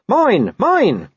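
high, loud barking "Mine, mine"; barked. A narrator's reading. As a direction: snap out "Mine, mine" like a small dog. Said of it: "He barked".

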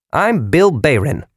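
high, slow, cheerful salesman voice; a chipper machine announcer; tinny mechanical voice. A narrator's reading. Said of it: "the tinny mechanical voice ... announced cheerfully".

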